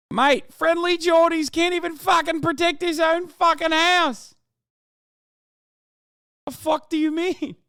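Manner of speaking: high, loud incredulous yelling; exasperated shout. Real, not acted. Streamer yelling back at a chat comment, exasperated shout.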